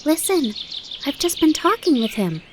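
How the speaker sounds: put-on high, breathless child news; excited. Fawn runs to tell his mother, high and excited. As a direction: burst out with the big news.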